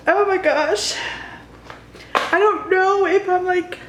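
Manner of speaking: real tearful catch in the voice; emotional. Real, not acted. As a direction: voice rising high and tight with emotion. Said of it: "I'm getting so emotional".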